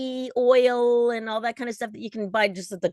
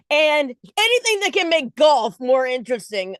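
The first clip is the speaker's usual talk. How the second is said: laughing; 6 semitones higher, 5 dB louder than usual. Real, not acted. Laughing aside about golf.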